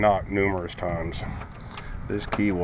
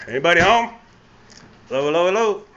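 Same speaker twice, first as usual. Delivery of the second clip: calling down a hallway; 8 semitones higher, 5 dB louder, much faster than usual. Real, not acted. Calling out entering a house.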